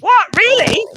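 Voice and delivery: high, loud disbelief; incredulous yelp at the news; astonished. Real, not acted. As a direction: react as if you can't believe it. Comic reacts to the cheer.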